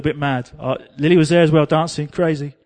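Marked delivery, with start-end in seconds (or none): none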